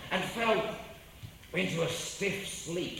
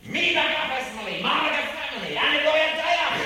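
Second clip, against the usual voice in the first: loud mock Hebrew; 8 semitones higher, 8 dB louder than usual. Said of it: "I'll do my Hebrew for you".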